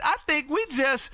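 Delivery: ordinary talk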